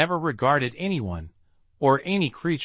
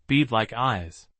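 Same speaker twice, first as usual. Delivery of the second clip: plain reading voice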